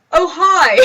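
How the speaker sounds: sudden high, loud "Oh, hi!"; fake cheery greeting. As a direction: fake a bright, startled hello to cover it up. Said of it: "we kinda just go".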